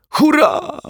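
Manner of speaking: very high, delighted hurrah; high-pitched cry of joy; overjoyed yell. A narrator's reading. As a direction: shriek it with glee. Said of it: "wrzasnął uszczęśliwiony detektyw Pozytywka".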